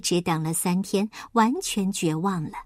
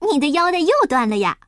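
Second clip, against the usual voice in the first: quick, loud, higher-pitched question; cheerful greeting; 5 semitones higher, 5 dB louder, faster than usual. Acted. Huahua greets the little pig, cheerful and quick. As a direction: ask brightly in a rush. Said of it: "花花快活地向小猪打招呼".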